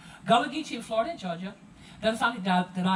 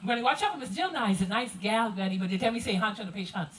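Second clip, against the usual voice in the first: pitched-up, running Gullah chatter to Granny; Gullah dialect. Acted. Grandchild warning Granny about Miss Jill, Gullah dialect. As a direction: tell Granny about the guest in thick Gullah. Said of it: "this is how the conversation would go".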